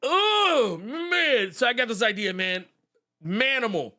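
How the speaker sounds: high-pitched, hammed-up pitchman with a stretched "Oh"; coked-up TV writer. TV writer pitches Manimal after a hit of cocaine, high excited mock voice. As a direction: pitch a wild idea like you're buzzing. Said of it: "they did this number here. They went".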